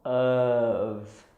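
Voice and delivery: "of" as UHV, long and voiced; V ending. Shown as a demonstration. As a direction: hold "of" into a buzzing V. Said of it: "we say the second word is".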